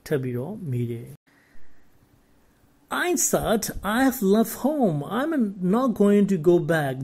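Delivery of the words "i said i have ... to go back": higher, lightly shouted insistence; mild shout. A narrator's reading. Boy refusing to go back home, mildly shouting. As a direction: call it out firmly, pitched up. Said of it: "the boy shouted".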